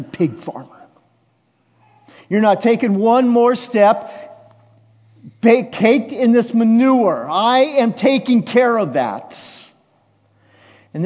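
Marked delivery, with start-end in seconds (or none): 2.3-4.1 high, loud, insistent; loud fatherly declaration. Acted. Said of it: "repeated louder". 5.4-9.4 shouted high, protective father's vow; loud fatherly declaration. Acted. Father voiced in sermon retelling.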